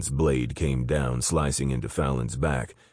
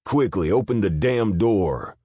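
high, loud desperate scream; 7 semitones higher, 5 dB louder than usual. Acted. Fallon screaming at guards behind the door. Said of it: "he screamed".

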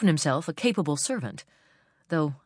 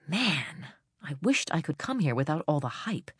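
plain reading voice

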